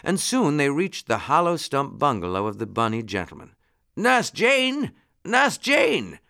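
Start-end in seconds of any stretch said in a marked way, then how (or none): 4.0-6.2 high, raised call for someone. A narrator's reading. Rabbit gentleman calling home to his nurse, calling out. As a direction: call the name out high, falling at the end. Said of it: "called Mister Longears".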